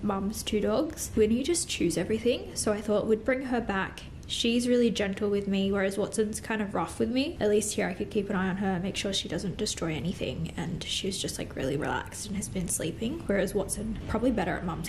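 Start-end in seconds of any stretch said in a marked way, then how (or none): none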